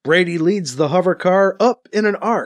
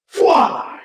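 raised, whooshing energy-gun shot; rifle blast sound; 10 semitones higher than usual. A narrator's reading. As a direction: imitate a laser blast with the mouth. Narrator voices rifle blast sound effect.

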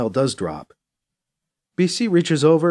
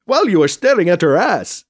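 louder, higher teasing jab; unhelpful interjection; 5 semitones higher, 5 dB louder than usual. A narrator's reading. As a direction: butt in to needle a friend. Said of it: "Krish interjects unhelpfully".

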